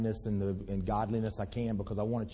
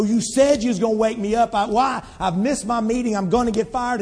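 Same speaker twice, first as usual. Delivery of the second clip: loud, high angry demand at the porter; furious retold yell; 10 semitones higher, 11 dB louder than usual. Salesman yelling at the porter who let him sleep, furious. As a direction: shout it like a salesman who overslept. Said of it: "gets so mad".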